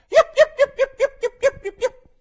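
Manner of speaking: high, loud little-dog yips; yapping dog imitation. Imitating the dog yapping at passers-by, high and loud. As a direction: yap like a small angry dog. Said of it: "comes out and goes".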